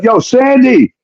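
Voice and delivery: high, urgent call for Sandy. Retold or imitated. Summoning Sandy to deal with the woman, high urgent call. Calling friend for help.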